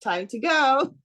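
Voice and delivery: mother chiding daughter to leave the car, playful high voice; playful mock prod. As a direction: nudge her out the door, lightly teasing. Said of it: "I'm like".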